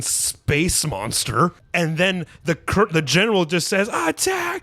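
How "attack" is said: attack. Retold or imitated. General orders attack.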